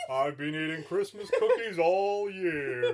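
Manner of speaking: put-on jolly Father Christmas; American Santa. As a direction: boast like a bad American Santa. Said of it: "He's American?".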